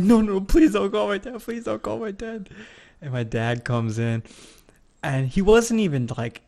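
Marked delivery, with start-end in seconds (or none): 0.0-2.5 retold bawling plea. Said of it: "I'm just still bawling".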